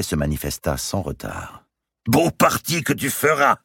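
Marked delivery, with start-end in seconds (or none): 2.1-3.6 high, forceful outburst of scorn; scornful exclamation. A narrator's reading. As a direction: cry out mockingly at your servant. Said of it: "s'écria Mao".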